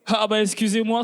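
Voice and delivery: ordinary talk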